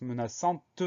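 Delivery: "menaçante" with the last T clearly released; audible final T. Shown as a demonstration. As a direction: sound out the T ending "menaçante". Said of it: "on entend le T d'un coup".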